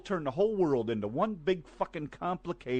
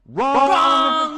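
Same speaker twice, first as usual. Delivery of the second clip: loud high shout of wrong; 9 semitones higher, 10 dB louder than usual. Real, not acted. Ranting host shouting down apologies, loud. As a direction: bark it out in a rage.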